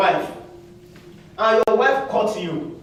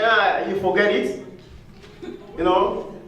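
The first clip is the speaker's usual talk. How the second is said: normal talking voice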